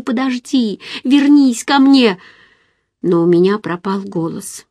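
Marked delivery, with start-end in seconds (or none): 0.0-2.2 higher-pitched, restrained cry after someone leaving; understated pleading shout; desperate call. A narrator's reading. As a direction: call them back, drawing out the last words slowly. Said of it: "Мне хотелось крикнуть ему".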